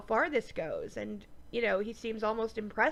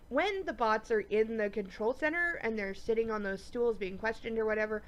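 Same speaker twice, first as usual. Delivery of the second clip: ordinary talk, nothing marked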